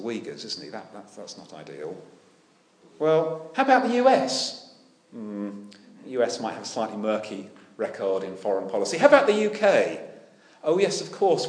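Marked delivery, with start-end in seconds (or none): 3.0-4.7 mock hopeful suggestion. 9.0-11.5 ironic mock question.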